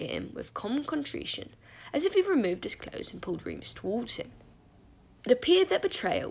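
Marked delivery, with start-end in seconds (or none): none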